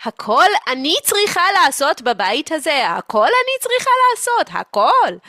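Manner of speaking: high, loud, escalating complaint; aggrieved martyr mother. Acted. Mother doing everything in the house, aggrieved. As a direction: build to a loud, high protest.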